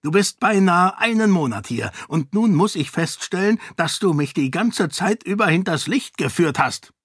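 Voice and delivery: the enraged king threatens her; angry king. Acted. (zorniger König, drohend)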